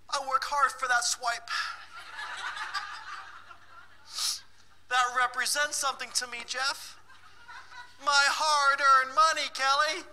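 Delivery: high, laughing mock-indignant whine; mock protesting participant. Teacher imitating participants Jeff and Kelly, high and laughing. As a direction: ham up the wounded saver. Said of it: "she's my personal. She said it's valuable".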